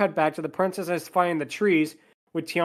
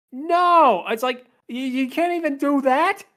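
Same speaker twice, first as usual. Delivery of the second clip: mock exasperation; 9 semitones higher, 3 dB louder than usual. Critic griping that she didn't learn, exasperated. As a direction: protest in exaggerated disbelief.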